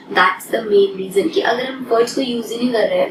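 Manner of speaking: ordinary talk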